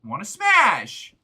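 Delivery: slow drawn-out teasing; slow mock tease. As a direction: taunt it slowly. Teasing the object.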